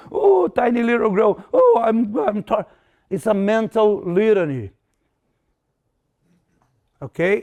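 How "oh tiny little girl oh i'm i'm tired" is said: loud, high, hammed-up whining; mock a crybaby at a shout; mock whiny crybaby. Inner voice mocking his own complaints, loud hammed-up whine. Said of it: "Why you crying like a sissy".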